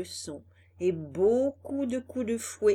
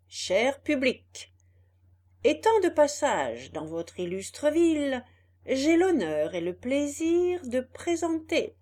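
showman speech; 4 semitones higher, 4 dB louder than usual. Acted. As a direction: address the crowd with pomp. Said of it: "commença le discours suivant".

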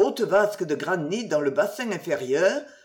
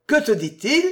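higher, louder question with a rising end; insistent repeat; 5 semitones higher, 5 dB louder than usual. Acted. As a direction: ask it again, pressing for the answer. He repeats it, biting her nape. (question répétée avec insistance, plus haute)

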